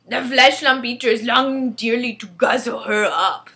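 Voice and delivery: loud, teasing giant voice; a hint of amused giant; giant, smiling a little. A narrator's reading. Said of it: "smiling a little now".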